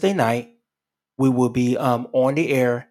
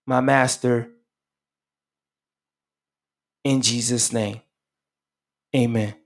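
leading a prayer. Acted. Pastor leads radio listeners in a repeat-after-me prayer. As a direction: pray it line by line so they can follow. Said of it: "just repeat it after me".

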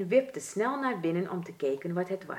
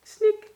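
high, louder single sob; mouse sob; 8 dB louder than usual. A narrator's reading. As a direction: snik hoog als een bang muisje. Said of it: "begon van angst te huilen". (hoge luide snik)

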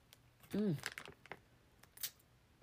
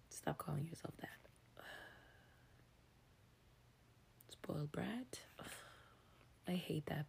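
whispering. Real, not acted. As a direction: whisper it close to the mic.

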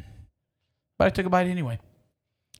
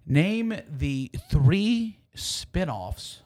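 game show host; much slower than usual. Pretend. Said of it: "sitcoms for four hundred, Alex".

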